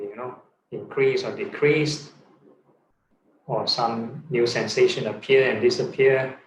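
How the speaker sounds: normal talking voice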